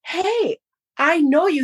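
high-pitched retold greeting; delighted recognition. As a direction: call out on spotting a familiar face. Greeting a recognized family friend.

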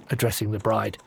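plain reading voice

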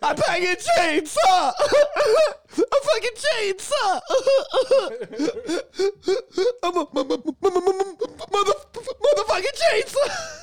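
very high, slow, wailing fake fury through laughter; scream-cry about a chainsaw, hammed-up; stuttering, hysterical mock rage; mock sobbing rage. Mock raging sob, chainsaw.

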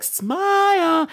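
high, sing-out mimicked child; little girl. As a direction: call it out in a put-on little girl's voice.

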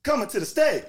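announcer voice. Acted. Announcer style intro.

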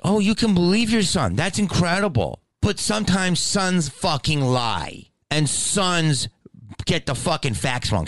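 high-pitched exasperated outburst; retold rant, voice shooting up; losing it. Telling a mother that sons lie, losing it. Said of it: "lost it".

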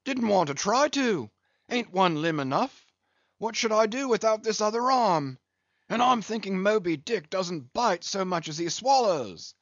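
high, animated captain telling his tale; hearty one-armed captain. A narrator's reading. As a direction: launch eagerly into the whale story.

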